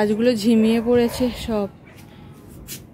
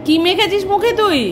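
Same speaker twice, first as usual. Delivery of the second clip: surprised scolding; 7 semitones higher than usual. Real, not acted. Mother finds child's face smeared.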